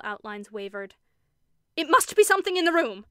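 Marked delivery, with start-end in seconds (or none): 1.8-3.1 high, loud, sharp declaration; snapping like a lash. A narrator's reading. Henry declares it to his frightened sisters, loud sharp snap. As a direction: crack the words like a whip. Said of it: "in a voice which seemed to snap like a lash".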